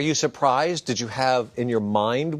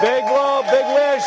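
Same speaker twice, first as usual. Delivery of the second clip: excited cheer; 12 semitones higher, 5 dB louder than usual. Real, not acted. Urging birthday candle blowing.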